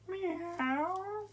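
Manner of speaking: high playful meow; cat meow. Pretend. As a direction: mew like a kitten. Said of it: "we're gonna make a cat noise".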